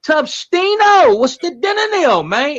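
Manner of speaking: high, loud, stretched hype call; hyped shout-out. Real, not acted. Hyping up a viewer on the live stream. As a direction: call his name out like a hype man.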